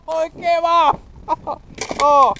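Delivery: loud high shout of dismay; dismayed outburst. Real, not acted. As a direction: cry out as the fish escapes. Fish gets off the hook.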